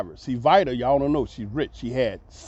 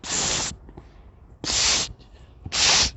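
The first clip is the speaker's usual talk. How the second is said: sharp hissed psst; psst call; 4 dB quieter, much slower than usual. Retold or imitated. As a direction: hiss the psst to call someone. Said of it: "real loud, sound like a loudspeaker".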